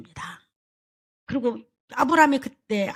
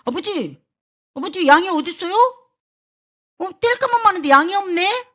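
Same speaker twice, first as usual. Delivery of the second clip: higher and louder, innocent questioning; Isaac asking his father where the lamb is, higher and louder; curious child Isaac; 8 semitones higher, 5 dB louder than usual. Acted. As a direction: ask like a puzzled boy.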